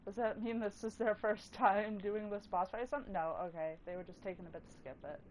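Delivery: usual speaking voice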